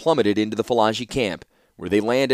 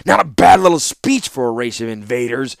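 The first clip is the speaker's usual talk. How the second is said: heated, louder warlord's retort; snarl; 4 semitones higher, 5 dB louder than usual. A narrator's reading. Warlord interrupting the envoy's speech at the conference, snarling. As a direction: cut in with a scornful snarl. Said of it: "interrupted with a snarl".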